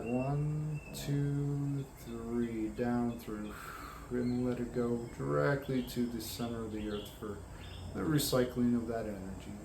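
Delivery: calm, unhurried guidance with long pauses; guided meditation. Acted. Guiding listeners to imagine grounding light, calm meditation voice. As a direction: lead them gently inward, drawing out key words.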